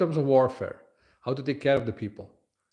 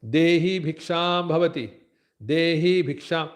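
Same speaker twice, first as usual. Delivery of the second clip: slow, raised, chant-like alms request; begging boy's call; 4 semitones higher, 3 dB louder, slower than usual. Acted. Kshatriya boy asking a mother for alms, slow chanted call. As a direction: stretch each Sanskrit word as a begging call. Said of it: "And when he says".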